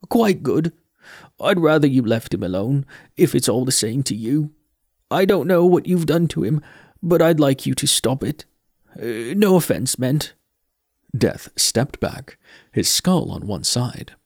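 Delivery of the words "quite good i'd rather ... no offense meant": put-on higher, earnest boy voice; nervous but firm boy; earnest young Mort. Mort pleads with Death.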